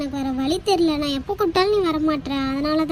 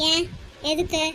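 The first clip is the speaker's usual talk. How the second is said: ordinary talk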